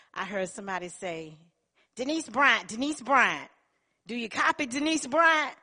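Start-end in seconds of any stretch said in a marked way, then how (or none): 1.9-5.6 loud, higher call over a radio. Retold or imitated. Boss paging Denise over the plant radio, calling loudly. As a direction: call her name across the plant.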